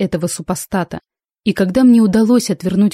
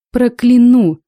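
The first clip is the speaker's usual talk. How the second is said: loud, higher, drawn-out curse; a hint of a voice from beyond the grave; sepulchral threat; 5 semitones higher, 6 dB louder, slower than usual. A narrator's reading. Heroine threatening to curse the pirate, sepulchral. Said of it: "заявила я таким замогильным голосом".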